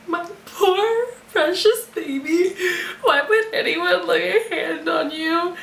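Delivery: hammed-up weepy lament, laughing through it; mock sobbing. Mock grief over book character.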